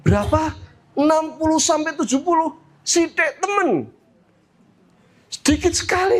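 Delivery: high-pitched incredulous questioning; shocked mother. Acted. Mother hears how short future lives are, shocked. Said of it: "Kaget ibu ini".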